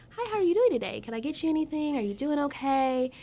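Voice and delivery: high, perky customer-service voice; super proper server. Shown as a demonstration. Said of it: "casual to super proper".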